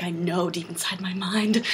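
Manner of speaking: near-whisper, very soft; hushed knowing. Real, not acted. Patient confiding her distrust of doctors, hushed. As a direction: drop to a secretive murmur.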